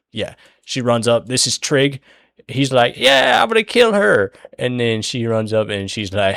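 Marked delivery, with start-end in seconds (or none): 3.0-4.3 high, loud cartoonish war cry; mock battle yell. Voicing Trig as he charges the killer, high mock yell.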